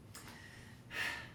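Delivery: a breathy, weary sigh; quiet tired sigh; soft sigh. Acted. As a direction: let the breath out slowly. Sigh between story scenes.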